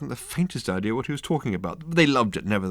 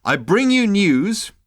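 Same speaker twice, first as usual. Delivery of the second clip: slow, higher, louder stress on you; insistent repeat; 12 semitones higher, 7 dB louder, slower than usual. A narrator's reading. Ford repeating his news to the captain, insistent. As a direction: lean on "you" and draw it out. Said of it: "Ford refused to be headed off".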